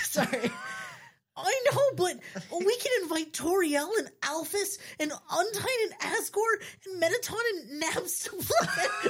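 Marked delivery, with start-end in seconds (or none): 1.4-9.1 very high, squealing excitement; excited screech. Acted. Blueberry lists everyone to invite, screeching excitedly. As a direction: screech out the guest list. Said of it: "he screeches".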